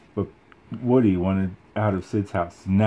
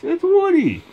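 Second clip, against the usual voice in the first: very high, louder shout; excited yell; 5 dB louder than usual. Acted. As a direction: shout the news to everyone. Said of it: "Mister Potato Head yelled".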